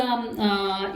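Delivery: ordinary talk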